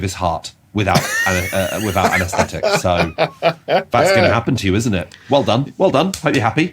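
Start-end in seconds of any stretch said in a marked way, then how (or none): 3.7-6.7 higher, sarcastic scolding; mock scolding. Cruel storybook voice taunting the child, sarcastic. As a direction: jeer at the naughty child.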